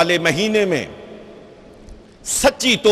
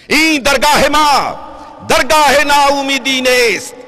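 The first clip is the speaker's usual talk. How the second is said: high, shouted verse; full-force Persian declamation; impassioned proclamation; 7 semitones higher than usual. Acted. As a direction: cry the whole line out to the crowd. Verse proclaimed a third time.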